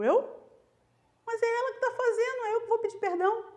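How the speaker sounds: high-pitched indignant "who, me?"; acted disbelief, voice jumping up high; incredulous protest. Reage incrédula à ordem de Deus. (protesto incrédulo em voz aguda)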